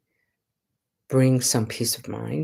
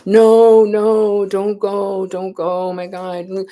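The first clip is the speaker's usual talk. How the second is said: high, loud pleading that fades toward pitiful begging; hammed-up relatives begging someone not to leave; imitating a grieving family wailing at the shore; mock wailing plea; 7 semitones higher, 5 dB louder than usual. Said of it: "crying and wailing and rending of garments and screaming".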